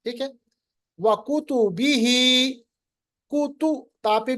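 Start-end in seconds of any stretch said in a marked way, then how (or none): 1.0-2.0 louder, measured tajweed recitation of وَكُتُبِهِ; Quran recitation. Shown as a demonstration. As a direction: chant each vowel clearly. Teacher recites Quran words.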